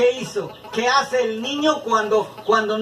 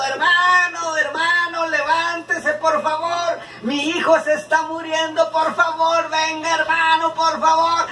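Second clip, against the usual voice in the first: higher, urgent pleading cry; mother imploring help for her dying son, higher and urgent; desperate pleading mother; 7 semitones higher than usual. Retold or imitated. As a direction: beg the preacher to come, frantic. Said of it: "muy desesperadísima gritando".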